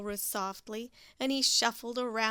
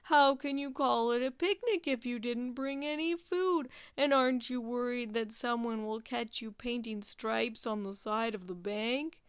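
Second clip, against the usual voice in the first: 1 fretful Walrus. Acted. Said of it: "cried Walrus".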